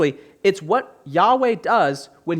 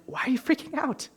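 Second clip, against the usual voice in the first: higher, fast, half-mocking disbelief; incredulous mock question; 6 semitones higher, 4 dB quieter, faster than usual. Preacher mocks Christians panicking at election time, quick incredulous higher voice. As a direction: lightly act out baffled disbelief at worried believers. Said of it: "And I'm like".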